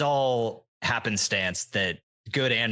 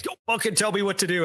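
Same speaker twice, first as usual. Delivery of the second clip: quick, higher mock snap at a bandmate; retold angry snap; 9 semitones higher, faster than usual. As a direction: imitate someone suddenly snapping back, fast and raised. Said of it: "snaps back at him".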